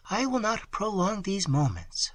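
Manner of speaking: Emily's farewell. A narrator's reading. A young woman ending the meeting, soft and high. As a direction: keep it hushed and sad. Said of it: "said she".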